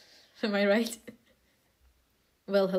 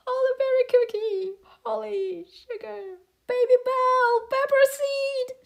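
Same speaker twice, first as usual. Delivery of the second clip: much higher, desperate calls of each name; panicked calling out; 12 semitones higher than usual. Acted. Cookie screams for her friends, frantic and much higher. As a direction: cry out for friends about to be lost.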